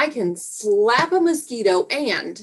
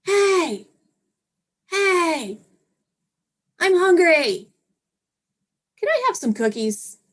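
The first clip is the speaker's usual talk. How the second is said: high, loud whining at the door; whiny kid voice; 8 semitones higher, 4 dB louder than usual. Pretend. Parent getting revenge by waking the kid, loud whine. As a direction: pester them awake, higher and louder.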